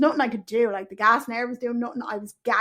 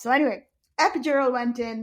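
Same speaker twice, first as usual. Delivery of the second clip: ordinary talk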